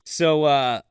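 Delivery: ordinary talk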